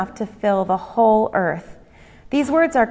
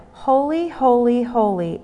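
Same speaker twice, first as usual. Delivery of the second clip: weight each "holy" as its own declaration; repetitive emphasis; 3 semitones higher than usual. Acted. One seraph called to the other, repetitive emphasis on "holy". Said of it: "the repetitive emphasis made in this declaration".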